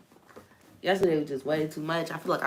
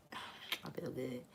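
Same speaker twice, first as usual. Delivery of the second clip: whispered; 5 semitones lower, 20 dB quieter, slower than usual. Real, not acted. Savouring the feeling for viewers, soft slow whisper. Contented afterthought.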